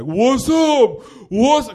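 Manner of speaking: high, loud mock bro challenge; douchebag bros. Comic mimics the guy's boys squaring up, loud douchebag holler. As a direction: holler like a cocky bro itching for a fight. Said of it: "'Cause they're douchebags".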